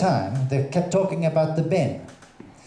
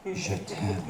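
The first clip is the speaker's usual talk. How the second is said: soft, lower "Je t'aime"; sultry murmur; 7 semitones lower, 10 dB quieter than usual. Shown as a demonstration. Comic to the audience, breathing je t'aime softly. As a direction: breathe "Je t'aime" slowly, seductively. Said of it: "breathy repeat".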